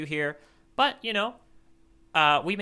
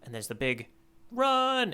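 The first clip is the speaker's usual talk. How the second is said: ordinary delivery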